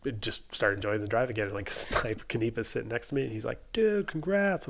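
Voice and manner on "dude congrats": high-pitched mock congratulations; imitated congratulation. Seatmate congratulating the mechanic, imitated enthusiasm.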